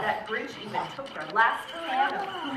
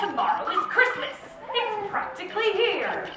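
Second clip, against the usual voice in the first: higher, louder Grinch voice with a sneering laugh; snarling Grinch; 9 semitones higher, 4 dB louder than usual. Acted. The Grinch sneering about Christmas, raised and louder. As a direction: sneer it out like a gleeful grouch. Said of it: "he snarled with a sneer".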